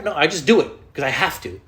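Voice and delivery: ordinary talk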